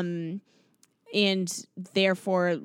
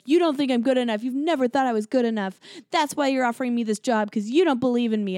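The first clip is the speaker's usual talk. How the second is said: raised, fast, hurt accusations; retold yelling; 4 semitones higher, 4 dB louder, faster than usual. Fiancé yelling at Cameron Diaz, acted-out angry rant. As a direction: throw the blame at her, half-acted. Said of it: "starts yelling at Cameron Diaz".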